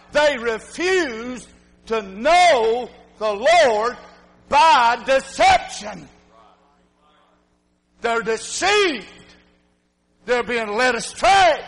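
slow, weighty preaching; slow emphatic preaching. Real, not acted. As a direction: spell it out slowly to the congregation. Preacher hammering the point.

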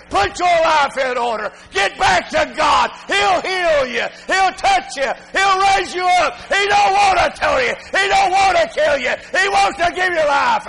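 shouted, high, loud revival preaching; fiery pulpit shouting; full-force preaching. Acted.

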